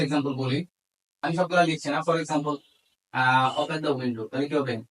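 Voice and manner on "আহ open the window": usual speaking voice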